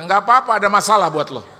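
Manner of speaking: loud, high-pitched comic retort; hammed-up voice from on high; God. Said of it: "Tuhan bilang begini".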